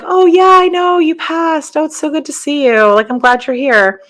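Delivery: bright, high, delighted hello to a visitor; happy surprised greeting. Retold or imitated. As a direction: greet her like a welcome surprise. Greeting dead aunt in bedroom.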